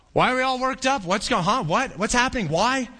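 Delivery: high-pitched bewildered questions; mock confusion. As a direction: act out a confused crowd. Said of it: "They're confused".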